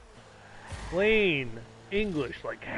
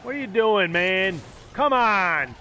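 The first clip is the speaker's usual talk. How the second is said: loud, raised-pitch protest; exasperated shout; 4 semitones higher, 5 dB louder, slower than usual. Real, not acted. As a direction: call out your frustration at the player. Calling out at opponent in game.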